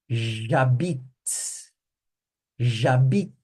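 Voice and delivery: J'habite said zha-BEET, stopping on the T; pron J'habite. Shown as a demonstration. Teacher modelling the end sound for Brazilian students: zha-BEET ending on the T. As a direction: Jabit with the final T clipped. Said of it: "A frase vem até aqui, ó, até o T, a pronúncia".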